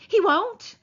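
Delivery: Lenny frantically denying the rabbit; frantic cry. A narrator's reading. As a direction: cry it out in panic. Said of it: "Lenny cried frantically".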